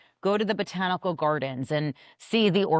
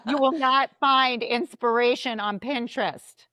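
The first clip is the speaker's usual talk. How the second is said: louder, higher, playful emphasis; repeating louder and sillier; 6 semitones higher than usual. Real, not acted. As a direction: say it again for the back row. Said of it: "say it again, louder for the people in the back".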